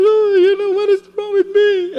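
high, loud crying plea. Retold or imitated. Man in despair cries out to the Lord, high tearful wail. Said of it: "And I was crying".

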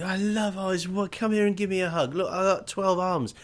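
a giddy many-armed creature begging for hugs; blissed-out alien. Acted. Martian gushing love at the humans, high and dreamy.

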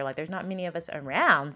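ordinary talk

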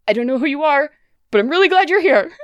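put-on high, fast, relieved girl voice; frightened young woman.

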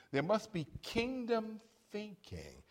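usual speaking voice

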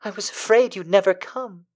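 high-pitched damsel voice; damsel in distress. A narrator's reading. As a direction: voice a rescued heroine, high. Heroine voiced in the story.

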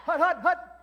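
loud high hut hut calls; quarterback calling cadence. Acted. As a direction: call the snap like a quarterback. Football play after verse cadence.

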